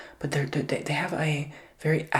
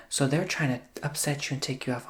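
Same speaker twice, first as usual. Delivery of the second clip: normal talking voice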